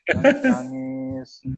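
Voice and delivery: high, drawn-out imitation wail; mock crying. Preacher re-enacting his first days in Semarang, mock wailing. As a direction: stretch it out like a hammed-up sob. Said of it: "saya nangis-nangis".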